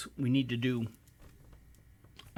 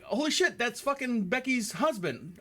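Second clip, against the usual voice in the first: surprised; 6 semitones higher than usual. Retold or imitated. Retold shock.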